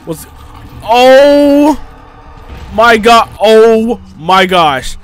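loud, high panicked cries; frantic real outburst; panicked yelling. Real, not acted. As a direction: yell in real alarm as it attacks. Under attack in the game.

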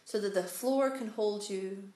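calm meditation voice, spacious pauses; unhurried, soothing instructions, letting each phrase settle; guided meditation. Real, not acted. Guides breath and body relaxation.

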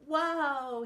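loud, thrilled wow; exclaiming. A narrator's reading. Mocha the dog feeling free on the mountain, thrilled exclaiming. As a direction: burst out with delight. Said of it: "he exclaimed".

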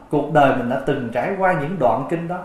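usual speaking voice

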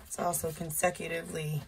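usual speaking voice

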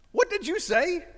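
high, loud, disbelieving question; can't believe what he just heard; stunned what. Retold or imitated. Father turning back to his son, stunned and high.

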